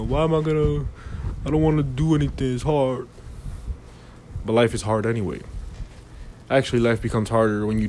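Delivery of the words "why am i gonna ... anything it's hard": mock whiny lazy voice, a bit higher; whiny excuse. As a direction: imitate a lazy person whining. Mocking a lazy person's excuse.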